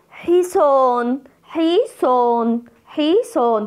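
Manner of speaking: "حصان" slowly, holding the aa on a sustained note; stretched long vowel. Shown as a demonstration. Teacher showing pupils the madd, stretched "aa" in "حصان". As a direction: drag out the long alif in "حصان". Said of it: "مد بالألف".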